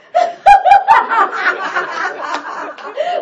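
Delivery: big rising laugh; loud delighted laugh; loud burst of laughter. Real, not acted.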